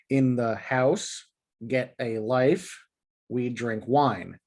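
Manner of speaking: slow, each phrase rising to its last word; da-da-DUM phrases, unhurried; anapest stress. Shown as a demonstration. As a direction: tap out In the house, get a life, we drink wine, weight on the final word. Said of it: "with the words being the unstressed and then stressed syllables".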